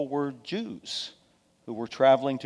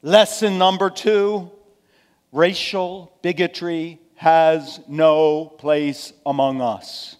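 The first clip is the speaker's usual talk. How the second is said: loud, high, emphatic sermon delivery; forceful preaching; 4 semitones higher, 8 dB louder than usual. Real, not acted.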